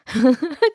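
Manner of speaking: high, loud burst of laughter; amused laugh. Real, not acted. Narrator tickled by her own story, laughing.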